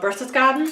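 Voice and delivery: Berchtesgaden with the throaty middle sound missing, roughly "BER-suss-gah-den"; attempted German pronunciation. Real, not acted. Narrator admitting she can't do German words, awkward attempt at Berchtesgaden as "BER-suss-gah-den". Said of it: "a little throaty sound in the back in the middle of that word, and I can't do it".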